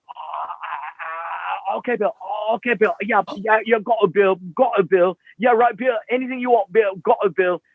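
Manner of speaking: meek, high, flustered yes-man; cowed roadie. Retold or imitated. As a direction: stammer agreement, backing down fast. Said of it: "then the guy's like".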